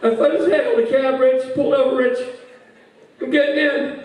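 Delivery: put-on high, monotone drunk voice; drunk Rob. Drunk friend updating Rich on the cab, high monotone. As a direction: report it plaintively like an overserved friend. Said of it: "phone call from Rob".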